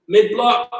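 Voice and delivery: ordinary delivery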